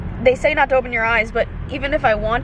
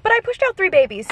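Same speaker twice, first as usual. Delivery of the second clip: higher, breezy brag; mock boastful mom; 9 semitones higher than usual. Woman from the lobby bragging lightly, higher. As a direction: shrug it off like nothing. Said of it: "But she's like".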